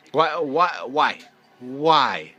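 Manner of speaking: loud, repeated demand for an answer; angry, loud pleading; exasperated. Real, not acted. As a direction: punch out each word in frustration. Exasperated at coach's decision.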